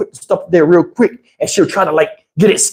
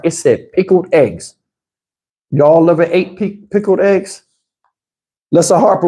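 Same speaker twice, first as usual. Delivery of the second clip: ordinary talk, nothing marked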